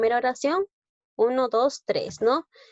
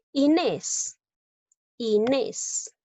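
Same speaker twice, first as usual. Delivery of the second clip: "Inés" said twice, NÉS stretched out; teacher sounding out "Inés" to expose the stressed NÉS; 3 semitones higher, much slower than usual. Shown as a demonstration. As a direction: lean on the final syllable of "Inés". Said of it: "sílaba tónica, nes".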